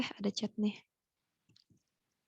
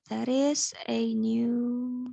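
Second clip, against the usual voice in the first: slow model reading; much slower than usual. Shown as a demonstration. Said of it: "teacher models sentence slowly".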